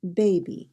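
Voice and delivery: put-on "baby" with the weight on BA; BA-by, clearly front-stressed; stress on first syllable. Shown as a demonstration. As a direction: lean on BA, let "by" fall away in "baby". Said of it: "The accent is on the first syllable".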